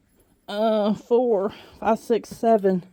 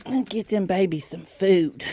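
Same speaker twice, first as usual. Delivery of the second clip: ordinary talk, nothing marked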